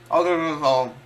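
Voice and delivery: talking while chewing; words round a mouthful; mouth full. Real, not acted. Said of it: "I can't talk with my mouth full".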